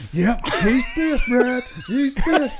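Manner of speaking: Billy taunting, high and slow; imitating Billy. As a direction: drag it out in a high, teasing voice. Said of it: "And Billy's like".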